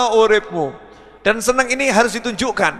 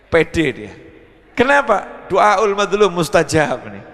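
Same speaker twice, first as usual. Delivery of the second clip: normal talking voice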